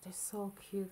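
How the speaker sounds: breathy hushed whisper. Real, not acted. Admiring the quilt on camera, whispered softly. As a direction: whisper it like a secret delight.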